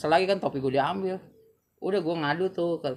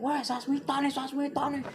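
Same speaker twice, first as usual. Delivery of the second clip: mock yell; 10 semitones higher, 4 dB quieter, slower than usual.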